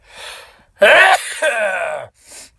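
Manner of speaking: loud explosive sneeze; big real sneeze, full force; sneezing. Real, not acted. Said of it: "is try to sneeze".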